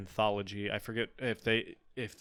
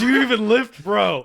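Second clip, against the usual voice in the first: gym bro; 13 semitones higher, 15 dB louder than usual. Pretend. Bodybuilder module joke.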